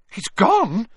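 high, loud, alarmed question; frantic. A narrator's reading. Harry learns Dumbledore has gone, frantic. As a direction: react as if all hope just left. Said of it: "said Harry frantically".

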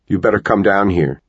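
ordinary delivery

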